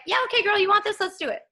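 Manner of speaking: high, loud playful hype voice; lightly voiced cosmic cheerleader, egging her on; mock universe voice. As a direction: play the universe as an excited girlfriend cheering her on.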